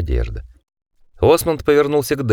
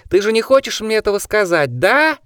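soaring opera diva pitch; 11 semitones higher than usual. Acted. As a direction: voice climbing to a high operatic shriek. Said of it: "голос Осмонда взлетел до невообразимых высот, словно он передразнивал оперную диву".

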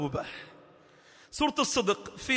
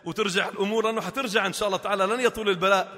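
normal talking voice

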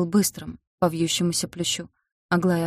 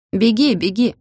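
jeering shouts; 4 semitones higher, 6 dB louder than usual. A narrator's reading. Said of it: "покрикивали вслед".

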